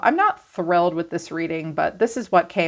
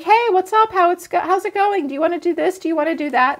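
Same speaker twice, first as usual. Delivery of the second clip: high, breezy, chirpy invitations; bubbly cheerful you; 11 semitones higher, faster than usual. Retold or imitated. Sagittarius greeting the moody one, in a high chirpy voice. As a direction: chirp like a sunny friend. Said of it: "you're like".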